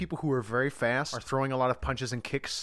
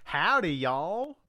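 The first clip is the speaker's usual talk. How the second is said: slow hammed-up hillbilly howdy; drawn-out mock country greeting; country accent; 4 semitones higher, much slower than usual. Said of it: "a country accent".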